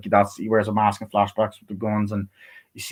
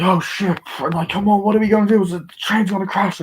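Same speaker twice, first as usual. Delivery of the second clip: higher-pitched panicked viewer reaction; mock panic; 10 semitones higher than usual. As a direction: act out alarm at the coming crash. Reacting to an action scene.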